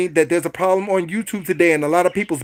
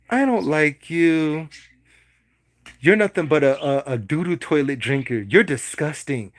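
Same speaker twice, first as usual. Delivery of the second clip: gentle tone; 4 semitones lower, 3 dB quieter than usual. Shown as a demonstration. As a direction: say insults like a tender compliment. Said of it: "it's like you're doing a gentle tone".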